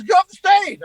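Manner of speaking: high, loud barked order; retold yelling. Headliner ordering his opener off stage, retold yell. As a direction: imitate an urgent holler from the wings. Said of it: "He kept yelling at me about getting off the stage".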